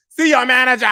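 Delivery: loud high laughing shout. Real, not acted. Vlogger repeating her punchline, laughing and shouting. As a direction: belt the punchline through laughter.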